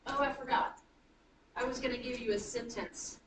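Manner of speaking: ordinary talk, nothing marked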